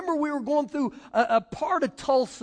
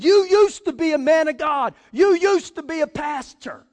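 raised, emphatic acted rebuke; bold confrontation; 4 semitones higher, 8 dB louder than usual. Bold evangelist confronts fallen pastor on balcony, loud rebuke. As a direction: call him out loudly, imitating a bold friend.

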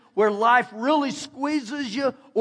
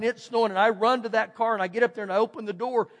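ordinary talk

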